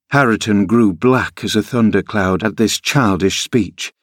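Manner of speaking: plain reading voice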